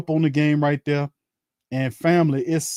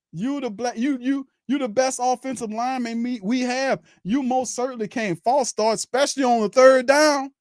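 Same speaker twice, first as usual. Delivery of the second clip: much higher, pleading, disbelieving cry; exasperated yelling; 9 semitones higher than usual. Retold or imitated. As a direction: scold the lineman like he can hear you through the TV. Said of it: "I just said".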